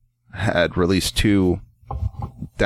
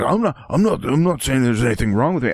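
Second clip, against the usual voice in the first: higher, fast flustered protest; flustered guy; 5 semitones higher than usual. Retold or imitated. The customer denying it, flustered and higher. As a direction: stammer it out in a higher voice. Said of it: "he was like".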